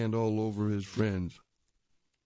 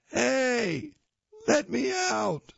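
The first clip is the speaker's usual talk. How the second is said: higher, slower little monster plea; dust bunny monster; 12 semitones higher, much slower than usual. Acted. Monster stuck in the vacuum, higher slow plea. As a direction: whine like a trapped dust monster. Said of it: "says the dust bunny monster".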